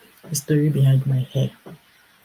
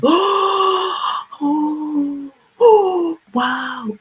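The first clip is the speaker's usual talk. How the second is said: very high gasping cries of shock; shocked gasps; 12 semitones higher, much slower than usual. Retold or imitated. Salon customers gasp at the haircut, high shocked cries. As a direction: cry out in stunned amazement.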